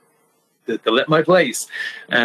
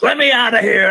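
mock scream; 8 semitones higher than usual. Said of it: "And I'm screaming".

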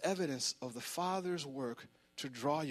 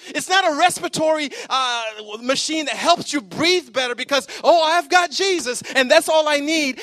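much louder and higher, shouting; full-force preaching; 10 semitones higher, 17 dB louder than usual. Real, not acted. Pastor preaching to the church at full force, shouting. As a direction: preach it to the rafters.

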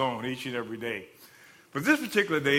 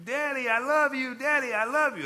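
imitating a child, high-pitched; lilting high voice of a little girl; little daughter; 10 semitones higher, 3 dB louder than usual. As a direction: call to Daddy as a small child would. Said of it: "This is a video of his daughter".

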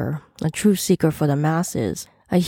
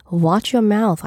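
plain reading voice

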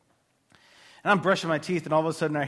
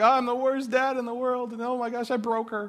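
high-pitched tearful self-blame, retold; imitated weepy panic, pitched high; mock crying; 7 semitones higher than usual. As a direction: wail it like a distraught dad, hammed up. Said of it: "I'm crying 'cause I'm like".

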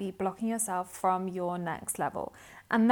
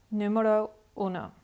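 numero uno said in Spanish; playful switch to Spanish. Real, not acted. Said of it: "speaking Spanish".

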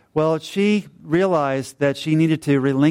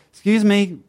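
calling out; 6 semitones higher, 3 dB louder than usual. Retold or imitated. Calling to the couple across the parking lot.

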